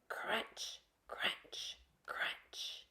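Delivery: soft whispered crunch sounds. Acted. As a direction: whisper each crunch like a footstep. Footsteps in snow, sound effect.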